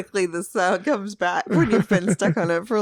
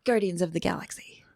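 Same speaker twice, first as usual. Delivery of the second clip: whispering; 4 dB quieter than usual. Real, not acted. Whispered guess in a game.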